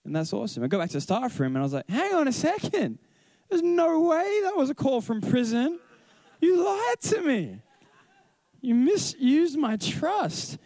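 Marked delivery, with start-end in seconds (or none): 1.9-10.6 high, drawn-out mock outrage; indignant accusation pitched way up, stretched for comic effect; going hard at it. Teacher confronting a student over the fake prison call, hammed-up outrage. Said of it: "apparently if you go hard at it".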